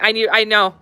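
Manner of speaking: usual speaking voice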